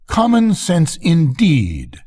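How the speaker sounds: slow, high, offended scoff; a proud rocket, high and slow with indignation; indignant. A narrator's reading. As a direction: drag the words out in haughty disbelief. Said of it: "said the rocket indignantly".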